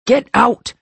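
loud furious order; angry. Acted. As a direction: bark it, high and angry. Nash angrily ordering cousin out.

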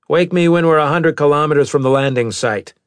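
stern captain voice. Acted. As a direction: voice the captain with authority. Maddox orders.

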